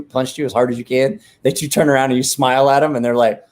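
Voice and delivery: ordinary talk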